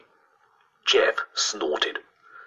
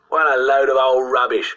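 loud, higher, cheery dismissal; cheerful scoffing; 7 semitones higher, 6 dB louder than usual. A narrator's reading. Boy butting in on a ghost story, cheerful scorn. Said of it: "came a cheerful voice".

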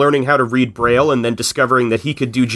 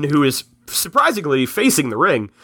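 usual speaking voice

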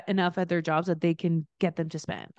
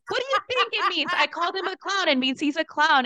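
high, snappy comeback; a mock-exasperated retort, pitched high; sassy retort; 8 semitones higher than usual. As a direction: toss it back like it's obvious. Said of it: "And I was like".

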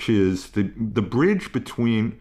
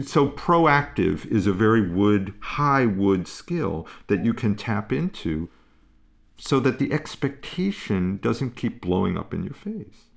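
usual speaking voice

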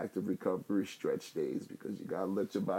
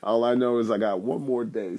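laughing loudly through words; 2 semitones higher, 11 dB louder than usual. Real, not acted. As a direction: laugh while you apologize. Laughs at losing count.

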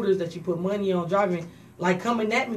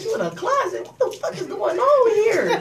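mock disbelief; 4 dB louder than usual. Hearing cousin hides in closet, high laughing disbelief. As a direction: act stunned at the news. Her own shocked reply retold.

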